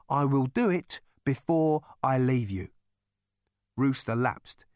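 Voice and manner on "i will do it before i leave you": deliberate pauses, landing with finality. A narrator's reading. Rooster repeats it with finality.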